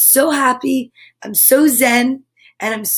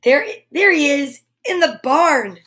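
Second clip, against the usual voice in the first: ordinary delivery, nothing marked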